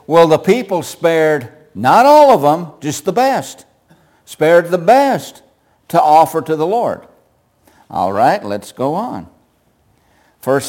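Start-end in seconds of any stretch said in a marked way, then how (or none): 0.1-7.0 Saul's excuse. Acted. Saul excusing himself to Samuel, loud and pleading. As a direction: sell the excuse, leaning on the best. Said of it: "what's the excuse he makes? He says".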